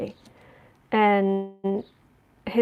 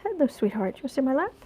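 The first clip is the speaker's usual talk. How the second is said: higher, soft pet talk; cooing to the cat; 3 semitones higher, 4 dB quieter than usual. Real, not acted. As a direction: coax the cat sweetly. Inviting cat onto lap.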